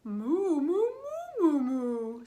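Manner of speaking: loud string of moos; cows mooing. Acted. Cows respond to the clues, loud mooing. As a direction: moo loudly in varying pitch. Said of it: "said the cows".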